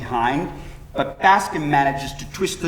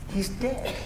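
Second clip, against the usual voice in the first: soft, higher-pitched disbelief; hushed grief; 5 semitones higher, 8 dB quieter than usual. A narrator's reading. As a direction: say it softly, stunned by loss. Widow realizing her husband is dead.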